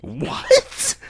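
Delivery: high, startled "What?"; sudden high yelp of disbelief; shocked. Acted. Hisao reacting to leaving his school, shocked.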